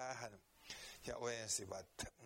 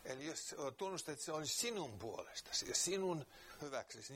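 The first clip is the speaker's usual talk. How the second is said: earnest preaching; 3 semitones higher than usual. Real, not acted. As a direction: press the point home to the listener. Preaching the gospel call. (kiihkeä saarnaaminen)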